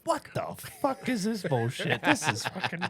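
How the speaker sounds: fed up and baffled; mock annoyed; irritated inner voice. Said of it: "sitting there going".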